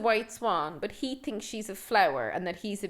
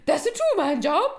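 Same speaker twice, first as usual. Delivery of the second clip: lightly put-on Irish lilt, a bit higher; mock Irish film line; 5 semitones higher, 4 dB louder than usual. Quoting the film to the co-host, in a light mock Irish voice. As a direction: quote it in a light stage-Irish voice. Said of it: "That's an actual line from the film".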